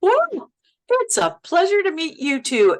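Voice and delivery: high, excited whoa of delight; starstruck gush. Retold or imitated. Actress meeting her idol, a high starstruck gush. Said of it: "I'm going".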